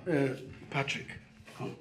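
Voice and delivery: quiet and gentle; soft reassuring. Acted. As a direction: soothe a scared child softly. Soothing child softly.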